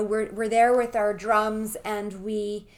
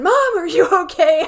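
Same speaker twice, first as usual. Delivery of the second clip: higher, louder worried call; son calling out; 8 semitones higher, 13 dB louder than usual. Retold or imitated. Teen son calling to his startled mother, loud worried call. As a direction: call out to mom in alarm.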